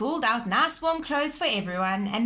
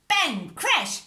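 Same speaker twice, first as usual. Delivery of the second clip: loud sound effects; 7 semitones higher, 6 dB louder than usual. A narrator's reading.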